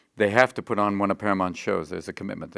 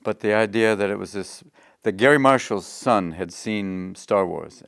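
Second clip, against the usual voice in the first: normal talking voice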